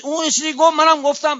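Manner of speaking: high, mock-indignant squabbling voice; defensive retort. A touchy friend defends his quarrel, high and indignant. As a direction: play the touchy friend justifying himself.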